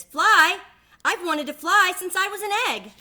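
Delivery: very high, loud, thrilled; the last duckling thrilled at the idea of flying; eager duckling. Acted. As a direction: burst with longing.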